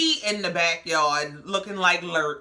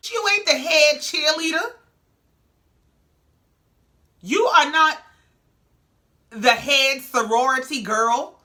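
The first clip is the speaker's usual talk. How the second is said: fed-up telling-off; 6 semitones higher, 5 dB louder than usual. Real, not acted. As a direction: read her to filth like she's standing right there. Telling off Mel directly.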